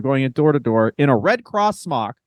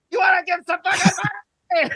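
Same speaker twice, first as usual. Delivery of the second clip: much higher, fast yelling pitch; mock aggressive shout; 13 semitones higher, faster than usual. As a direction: bark it at the homeowner like a pushy fundraiser. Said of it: "be like".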